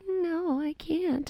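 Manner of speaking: high, soft little voice; imitated small, helpless sister; mock pitiful refusal.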